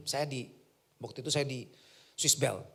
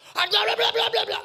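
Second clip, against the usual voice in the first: loud, high glaring rant; angry gibberish; 9 dB louder than usual. Retold or imitated. The spiritual woman glaring and pointing at [name], loud high ranting. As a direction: rant fiercely in nonsense syllables. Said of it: "matanya melotot nunjuk saya".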